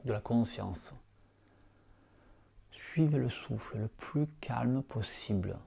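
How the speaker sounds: soft, hushed meditation voice, unhurried; guided meditation. Acted. Teacher guiding lying students through yoga nidra, soft meditation voice. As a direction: ease listeners into calm breathing awareness.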